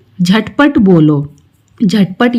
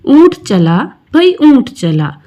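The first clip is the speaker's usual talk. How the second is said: poem recitation; 4 semitones higher than usual. Acted. Closing refrain of recited poem.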